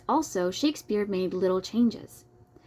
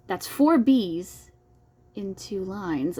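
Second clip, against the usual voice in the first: slow emphatic count; much slower than usual. Real, not acted. Counting the B's slowly.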